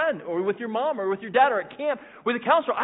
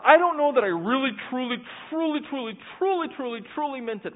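anxious doubter; 6 semitones higher than usual. Retold or imitated. Doubting believer voiced.

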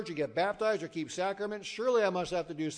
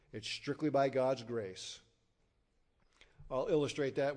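ordinary delivery

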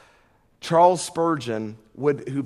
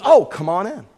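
cheery welcome; 5 semitones higher, 8 dB louder than usual. Retold or imitated. Woman's cheerful welcome, retold.

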